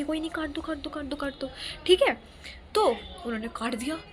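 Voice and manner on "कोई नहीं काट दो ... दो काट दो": softer, furtive aside; hushed conspiratorial repeat; covert signalling. Retold or imitated. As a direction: imitate secretly telling someone to go ahead. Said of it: "इशारों में".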